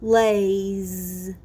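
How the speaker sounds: Lays drawn out, with a buzzing z at the end; teacher modelling a word. Shown as a demonstration. Teacher modelling the last word for the class. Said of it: "And the last one is lays".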